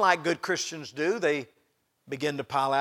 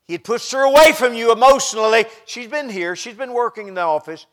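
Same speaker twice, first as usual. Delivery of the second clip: loud, raised preacher's voice; forceful preaching; 7 semitones higher, 15 dB louder than usual. Real, not acted. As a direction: press the point home to the congregation. Preaching outburst.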